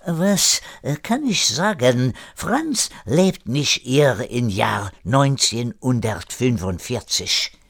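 put-on deliberate French-accented German. René hinting at Franz's fate, deliberate French accent. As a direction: explain haltingly in a French accent.

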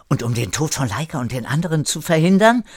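hastig, schnell und etwas lauter; schnell gefragt. Acted. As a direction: sprudle die Frage schnell heraus. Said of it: "fragte Kire schneller". (Kire fragt hastig nach Laikas Rettung)

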